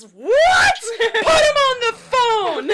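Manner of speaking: high, full-volume retold scream; screamed outrage. Grandma screams down the phone at granddaughter, outraged. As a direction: shriek the order, stretching it out. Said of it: "'cause she screamed".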